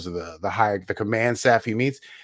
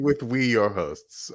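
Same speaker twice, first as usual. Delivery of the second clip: mock grand; slower than usual. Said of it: "I like you saying".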